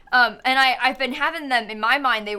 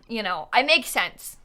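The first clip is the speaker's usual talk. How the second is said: ordinary talk, nothing marked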